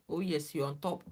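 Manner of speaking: ordinary talk, nothing marked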